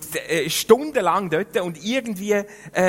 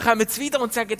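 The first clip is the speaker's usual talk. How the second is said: ordinary talk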